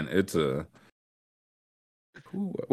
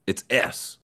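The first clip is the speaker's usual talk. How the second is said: ordinary talk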